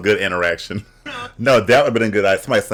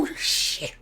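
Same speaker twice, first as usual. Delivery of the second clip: high hushed "ooh", trailing into a whisper; acted recoil, breathy and much softer; mock disgusted reaction; 7 dB quieter than usual. Reacting to a smell that hits you, mock disgust. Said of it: "when you about to go down... You go".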